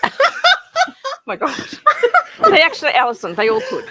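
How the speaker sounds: words squeezed out through high laughter; cracking up mid-sentence; laughing. Real, not acted. Cracking up at quilt joke.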